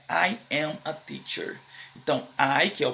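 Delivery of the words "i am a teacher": slow model sentence. Shown as a demonstration. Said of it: "A afirmação é".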